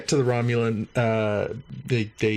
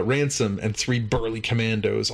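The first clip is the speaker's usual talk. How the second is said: ordinary talk, nothing marked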